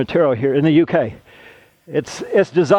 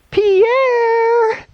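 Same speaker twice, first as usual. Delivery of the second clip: very high, drawn-out teasing call of the name; mocking taunt. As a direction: sing out Pierre like schoolboys teasing a friend. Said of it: "And they were like".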